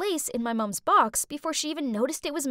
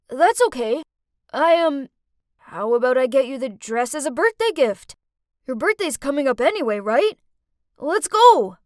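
louder, keen and excited; eager sweet boy; 8 dB louder than usual. Acted. Dorky boy eagerly offering to buy her the dress, loud and keen. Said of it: "smiled sweetly".

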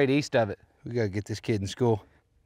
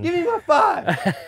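high, loud, stretched-out victory yell; triumphant demand; 5 dB louder, slower than usual. Real, not acted. Golfer claiming five dollars won from friend, yelling triumphantly. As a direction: yell for your winnings, elated. Demanding winnings after the shot.

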